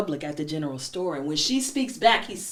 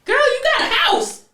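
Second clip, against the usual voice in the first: much higher, louder, laughing mock shout; grandmother voice; 7 dB louder than usual. Grandmother laughing off the bride's wish for love, loud high shout. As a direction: scoff at love, hollering and cackling. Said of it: "the grandmother was like".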